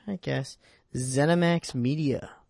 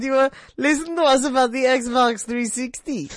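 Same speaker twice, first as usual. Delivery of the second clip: cool foreign spy menace; 9 semitones higher, 4 dB louder than usual. Pretend. Mock menacing Gamefront voice.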